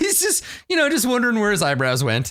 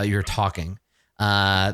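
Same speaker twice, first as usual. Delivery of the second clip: ordinary talk, nothing marked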